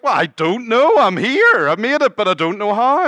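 high, loud, helpless protest; bewildered thief. Retold or imitated. Thief explaining to the angel he just made it, high and loud. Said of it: "And the thief's like".

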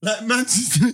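words breaking into laughter; laughing while talking. Real, not acted. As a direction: talk through the giggles. Cracking up while explaining.

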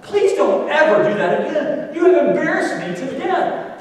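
high, loud exasperated rant; acted scolding. Embarrassed husband to wife in car, exasperated scolding. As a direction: scold at full voice, pitched high.

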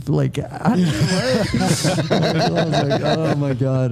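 slow, laughing oh my God; drawn out through laughter; laughing disbelief. Retold or imitated. Laughing at dive team's reaction.

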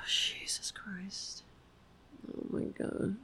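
very soft, voiceless, overwhelmed; real tearful. Real, not acted. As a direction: whisper it through tears. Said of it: "Now I'm gonna cry again".

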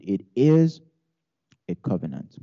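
normal talking voice

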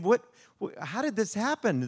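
high-pitched disbelief, retold; astonished parents, acted. Parents hugging their found son, acted astonishment. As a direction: stammer in mock amazement, pitched well up.

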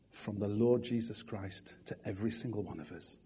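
soft, low prayer voice; hushed congregational prayer. Acted. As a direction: pray quietly and earnestly.

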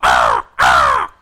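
two loud, low crow caws; crow call. Shown as a demonstration. Pastor demonstrates a crow call for the congregation, loud caws. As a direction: caw twice like a crow, loud. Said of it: "but a crow call".

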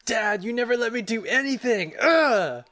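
put-on high, whiny teen complaint; whiny teenager. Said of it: "and is like".

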